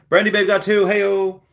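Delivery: hype celebration. Acted. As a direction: cheer the viewer's win like a hype man. Celebrating another viewer's drop.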